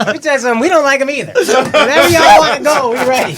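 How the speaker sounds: tough guy voice. Pretend. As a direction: puff up like a street tough. Playful tough-guy reply.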